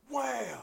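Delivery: high, loud "wham"; imitated slam. Telling how he slammed the phone, sudden loud wham. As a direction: burst out the noise of a slam.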